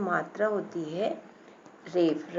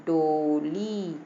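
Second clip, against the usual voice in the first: डोली with both syllables held long; taking time; 3 semitones lower, much slower than usual. Shown as a demonstration. Teacher shows children the word takes time, drawn out. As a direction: stretch out डोली very slowly. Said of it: "इसको भी बोलने में time लग रहा है".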